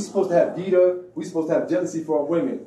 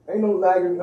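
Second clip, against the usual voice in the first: ordinary delivery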